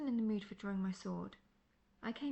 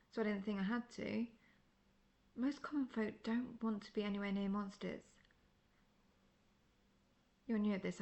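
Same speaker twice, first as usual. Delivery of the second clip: ordinary talk, nothing marked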